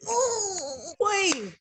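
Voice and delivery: high, drawn-out ooh of admiration; playful, savoring exclamation; swooning reaction. Real, not acted. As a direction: let it swoop down from high. Hammed-up reaction to a body.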